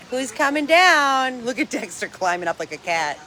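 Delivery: ordinary delivery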